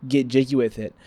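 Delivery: ordinary talk, nothing marked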